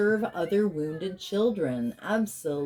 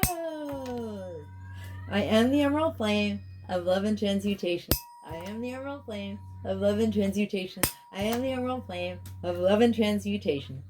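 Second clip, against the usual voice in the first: higher, sing-song chanted invocation; healing invocation; 5 semitones higher, faster than usual. Real, not acted. Healer invoking release for the Divine Aquarius Collective, chanted. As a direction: intone it like a ritual prayer.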